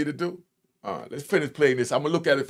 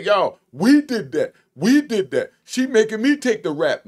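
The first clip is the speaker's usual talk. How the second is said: mock snitch whine; 7 semitones higher, 5 dB louder than usual. Mocking a snitch who blames his partner, higher and louder. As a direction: play the snitch blaming her. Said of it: "You don't say".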